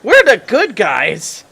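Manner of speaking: high, drawn-out exclamation; loud protest. Real, not acted. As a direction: cry it out in slow, emphatic objection. Protests a torture plan.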